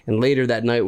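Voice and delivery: ordinary talk, nothing marked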